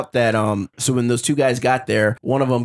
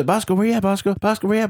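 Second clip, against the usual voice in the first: higher calling out for a lost dog; 8 semitones higher, faster than usual. Retold or imitated. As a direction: call out across the yard for Bosco.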